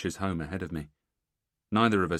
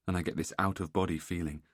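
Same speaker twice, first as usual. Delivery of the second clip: ordinary delivery, nothing marked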